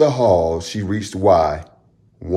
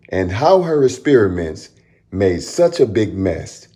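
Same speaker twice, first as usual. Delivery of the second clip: ordinary delivery, nothing marked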